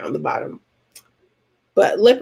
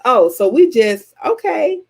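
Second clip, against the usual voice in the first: high, slow disbelief; taken aback, pitch jumping up on okay; surprised; 5 semitones higher, 3 dB louder, slower than usual. Retold or imitated. Watching a camper stomp the roach, stunned. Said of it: "I'm like".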